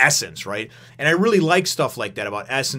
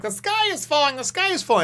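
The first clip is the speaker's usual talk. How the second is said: much higher, squeaky voice of a little fella; high squeaky panic. Retold or imitated. Little Komatsu panics at the explosion, high squeaky cry. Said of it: "in a high-pitched, squeaky sort of tone".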